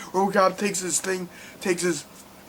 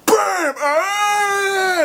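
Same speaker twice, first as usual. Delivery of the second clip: loud, high bam then a shrieking death cry; gunshot and scream; 4 dB louder than usual. Retold or imitated. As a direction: make the shot, then scream as you fall.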